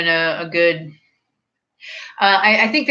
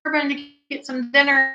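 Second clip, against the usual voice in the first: casual friend voice; 9 semitones higher than usual. Retold or imitated. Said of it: "She's like".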